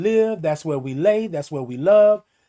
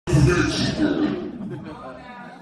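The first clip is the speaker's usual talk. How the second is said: breathy high laugh; 7 semitones higher than usual. Real, not acted. Laughing after punchline.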